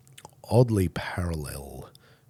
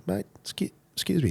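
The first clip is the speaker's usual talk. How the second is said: higher-voiced, halting approach to someone; hesitant stranger; 14 semitones higher than usual. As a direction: imitate a hesitant stranger, pitched high. A stranger interrupts him, retold.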